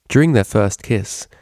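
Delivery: ordinary delivery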